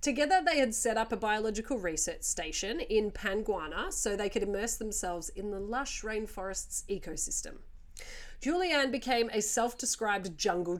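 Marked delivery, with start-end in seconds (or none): none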